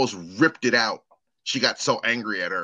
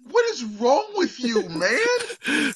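much higher, incredulous mock outrage; exasperated disbelief; 12 semitones higher, much slower than usual. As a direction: throw up your hands at the dad. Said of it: "it's like".

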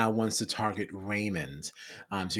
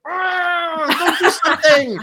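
high, loud comic outburst; hammed-up scream of annoyance; mock exasperated shout; 9 dB louder, slower than usual. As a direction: yelp in irritation, half laughing. Acts out irritation at Kirby watching.

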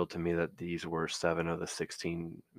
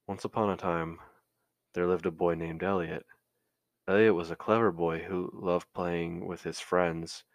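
louder sing-song fairy tale voice; storybook reading; 5 dB louder than usual. Acted. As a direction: read it like a bedtime story.

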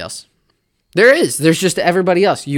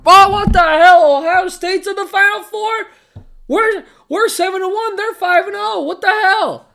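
much higher, loud, indignant mock fan rant; outraged Texas A&M fan; 6 dB louder than usual. Mocking an A&M fan upset about Ohio State, high and loud indignant rant. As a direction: whine like a fan who feels robbed. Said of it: "if a Texas A and M fan is sitting there going".

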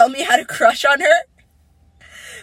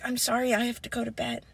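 soft, lower dismissive voice; low-key mock apology, much softer; retold brush-off; 4 semitones lower, 11 dB quieter, faster than usual. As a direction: quietly imitate someone bowing out. Ex brushing off the plea.

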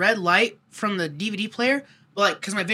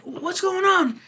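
dad asking; 11 semitones higher, much slower than usual. Retold or imitated. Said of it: "he's like".

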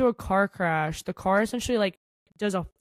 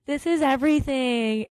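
higher-pitched mocking imitation; 6 semitones higher than usual. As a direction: mimic the catchphrase to tease. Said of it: "he's mocking me".

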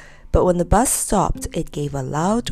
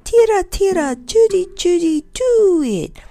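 very high, loud, piping birdsong; trilled bird tune; 12 semitones higher, 5 dB louder than usual. A narrator's reading. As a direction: trill it like a blackbird's little tune. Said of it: "trilled out a dear little tune".

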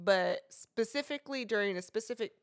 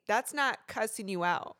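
usual speaking voice

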